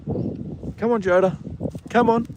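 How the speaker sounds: high, breathy-soft call of come on; coaxing call to the horse. Real, not acted.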